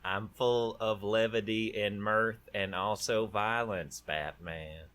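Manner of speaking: slow, creepy Southern villain voice; drawn-out menace, Truman Capote style; Joker. Shown as a demonstration. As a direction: savor each word like a creepy villain. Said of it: "southern, scarier Truman Capote kind of Joker".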